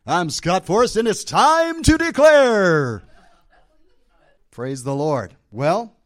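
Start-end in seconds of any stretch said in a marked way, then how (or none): 0.0-3.0 big booming game show announce, higher and louder; game show host voice. Pretend. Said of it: "Sound like a game show host".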